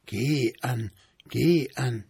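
hushed spectral coaxing; ghostly whisper. A narrator's reading. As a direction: breathe it like a little spirit luring her. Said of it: "und flüsterte wieder".

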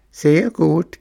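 high, squeaky little voice; tiny shrieking voice. A narrator's reading. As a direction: shriek it like a tiny hidden creature. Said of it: "kreischte ein feines Stimmchen".

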